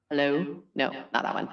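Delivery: low voice-changer hello; a low, testing hello through a filter; voice effect test. Shown as a demonstration. Host hunting for a deep voice preset, low test hello. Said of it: "Let me see if I have a".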